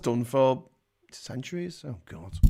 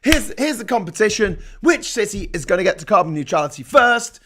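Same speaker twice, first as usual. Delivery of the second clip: lightly acted, loud competition announcement; contest starter; 5 semitones higher, 5 dB louder than usual. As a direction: launch the race, building to a loud "Go". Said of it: "pick comparable cities and say".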